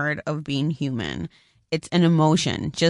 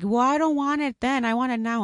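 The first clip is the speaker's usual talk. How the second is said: put-on whiny child; 7 semitones higher than usual. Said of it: "she's like".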